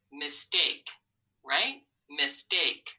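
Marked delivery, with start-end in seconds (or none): none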